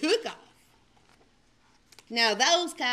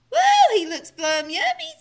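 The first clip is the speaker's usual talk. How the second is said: greedy delight; 6 semitones higher, 8 dB louder than usual. Acted. She coyote relishing.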